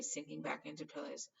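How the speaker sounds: plain reading voice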